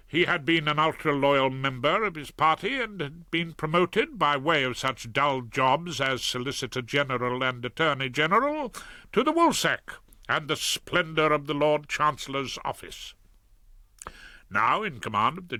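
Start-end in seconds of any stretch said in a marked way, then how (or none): none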